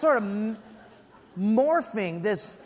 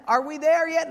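put-on higher, louder whiny child; nagging kid; 6 semitones higher, 5 dB louder than usual. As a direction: whine it like a bored little kid. Kids nagging from back seat.

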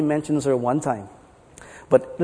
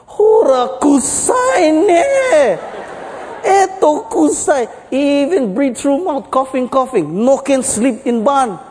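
much higher, slow wailing cry of disgust in Japanese; Japanese-accented outcry; 9 semitones higher, 4 dB louder, slower than usual. Acted. Japanese guy fleeing the barn, high slow wail. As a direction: wail it high, stretched and pained. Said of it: "It's the Japanese guy".

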